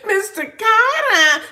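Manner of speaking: high, slow words squeezed through laughter; giggling while reading out a comment; laughing. Real, not acted. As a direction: read it while cracking up.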